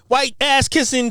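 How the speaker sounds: usual speaking voice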